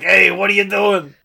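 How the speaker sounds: opossum. Pretend. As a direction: yell it as a joke talking opossum. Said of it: "for the opossum to be like".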